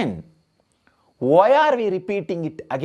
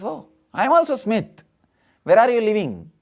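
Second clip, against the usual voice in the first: high-pitched surprised bar patron; mock amazement; 6 semitones higher, faster than usual. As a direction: act delighted at a coincidence, pitched up.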